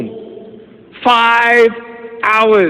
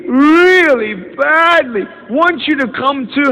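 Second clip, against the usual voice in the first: imitating the caller; 6 semitones higher than usual. Caller asking him to school.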